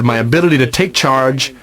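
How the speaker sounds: normal talking voice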